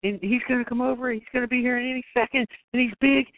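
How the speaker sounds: high, fast, frightened threats to the caller; a hammed-up scared young woman bluffing; panicky girl voice. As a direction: squeak out the boyfriend threat in a rush. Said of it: "she's like".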